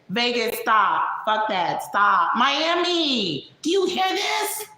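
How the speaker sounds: exasperated interruption climbing high; mock protest rising to a high put-on voice; [name]. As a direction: cut in sharply, then wail to a friend in disbelief. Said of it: "that was [name] breaking in".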